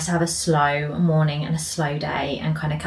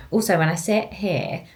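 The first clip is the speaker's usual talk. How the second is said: ordinary talk, nothing marked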